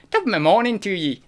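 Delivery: high, lilting attempt at an Irish accent. Shown as a demonstration. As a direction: have a bad go at Irish. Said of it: "I can't do Irish".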